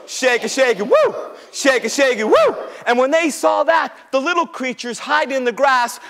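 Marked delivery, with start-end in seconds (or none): 0.1-2.6 shaking branches. Acted. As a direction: chant it and leap up on "woo". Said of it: "started shaking like this".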